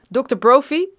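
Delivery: loud call across the snow. A narrator's reading. Armed man calling to the geologist from the helicopter, loud. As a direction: shout the name to get his attention. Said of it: "riep de ene".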